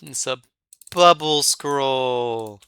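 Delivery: slow, high and loud; drawn-out announcer. Pretend. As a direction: stretch it out grandly. Finding scroll, drawn out.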